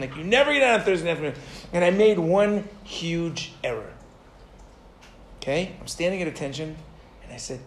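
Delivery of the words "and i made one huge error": usual speaking voice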